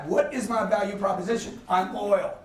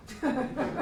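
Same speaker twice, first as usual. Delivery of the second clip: laugh; 4 semitones higher, 4 dB quieter than usual. Real, not acted. Laughs at his own story.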